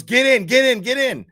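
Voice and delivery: loud, high, hurried command; urgent call. Retold or imitated. Woman picking him up at the tracks, urgent shout.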